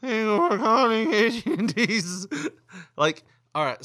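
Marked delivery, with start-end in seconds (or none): 0.0-2.3 high, slow, loud laughing parody of the greeting; mocking the rep. Caller mocks scam rep's greeting, high and laughing.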